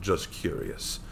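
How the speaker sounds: ordinary delivery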